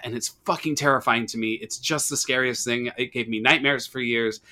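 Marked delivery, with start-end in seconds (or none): none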